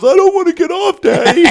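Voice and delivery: put-on loud, very high child's wail; little daughter. Dad imitates daughter loving the ride, loud high child voice. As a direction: cry out like a thrilled kid refusing to leave. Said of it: "And then she goes".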